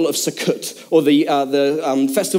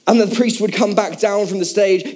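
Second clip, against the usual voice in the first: ordinary delivery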